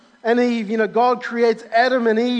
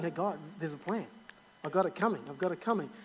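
tender God voice; 5 semitones lower, 12 dB quieter, faster than usual. Acted. God voiced encouraging people.

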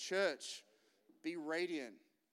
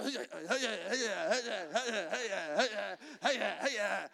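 mock cheering guys; 4 semitones higher, 4 dB louder than usual. Guys passing cheering the car.

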